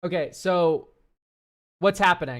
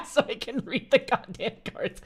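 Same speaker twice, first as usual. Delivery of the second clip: laughing; 6 semitones higher, 3 dB louder than usual. Real, not acted. Laughing at own remark.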